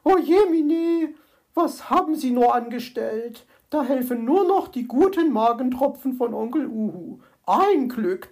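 high, loud dismayed magpie; alarmed fussing. A narrator's reading. Magpie to the groaning fox, alarmed and fussing. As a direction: fuss over the patient in dismay.

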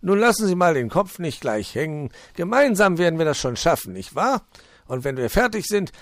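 high, loud, jolly fox voice; high-pitched welcoming fox; cheerful fox. Acted. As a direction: play the hospitable old fox, beaming. Fox voice held.

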